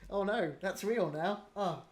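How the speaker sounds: high, hammed-up dawning panic, bursting loud at the end; mock panic. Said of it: "And we're like".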